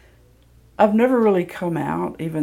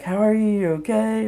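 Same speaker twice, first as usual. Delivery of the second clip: higher-pitched, flustered concern, re-enacted; mock anxious check-in; 6 semitones higher than usual. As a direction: hurriedly fuss over your partner, hammed-up. Acted turning quickly to partner.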